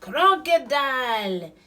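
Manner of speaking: loud, drawn-out high shout; angry yell. A narrator's reading. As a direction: bellow the culprit's name. Animals yell at Crocodile.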